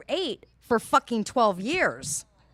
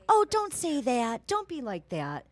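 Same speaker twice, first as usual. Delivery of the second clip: imitated clerk soothing; 4 semitones higher, slower than usual. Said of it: "she goes".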